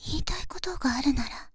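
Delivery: scolding whisper. Acted. (叱るささやき)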